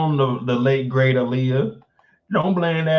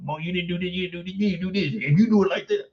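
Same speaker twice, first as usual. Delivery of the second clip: mock nagging; 3 semitones higher, faster than usual. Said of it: "Kendall be going in on me".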